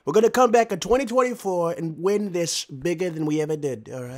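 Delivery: lightly voiced Trump promise; a hint of Trump making a campaign vow. Gamer promising squadmates a 2024 comeback in a light Trump voice. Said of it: "He's doing Trump now".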